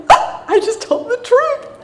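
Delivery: high, slow, giggly self-praise; proud squeal. Retold or imitated. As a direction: gush about telling the truth. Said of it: "I'm like".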